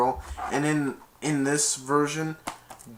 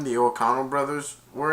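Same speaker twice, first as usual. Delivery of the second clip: ordinary talk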